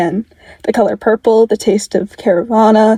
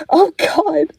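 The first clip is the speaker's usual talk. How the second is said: higher, breaking into a sob; choked sob; 7 semitones higher than usual. Acted. Dick on the line as Tim fades, sobbing higher. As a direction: let the voice crack on a cry. Dick hears brother dying.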